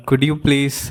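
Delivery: ordinary talk, nothing marked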